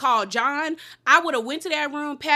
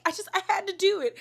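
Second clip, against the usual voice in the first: high, quick mock-tearful voice; imitated crying; 7 semitones higher, faster than usual. Recapper imitating Heather crying to castmates, mock tearful. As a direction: mimic a tearful excuse, pitched up and rushed. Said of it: "and she's crying".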